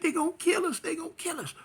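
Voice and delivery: very high scared repeating; panicked. Retold or imitated. Girlfriend insisting they'll be killed, high panic. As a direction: cry it out in panic. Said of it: "She like".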